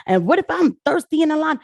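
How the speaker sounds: mocking whine. Mocking voter complaint.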